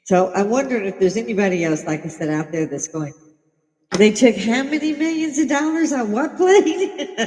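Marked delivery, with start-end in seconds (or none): 4.0-7.2 incredulous laughing quote, much higher and louder; mock disbelief. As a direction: ask it as if you can't believe it.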